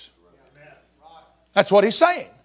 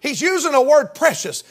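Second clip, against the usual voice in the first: ordinary talk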